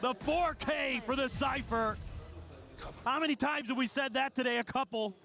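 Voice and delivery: high-pitched hype commentary; esports caster. Acted. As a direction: sell the comeback, slow and big. Analyst hyped after the round.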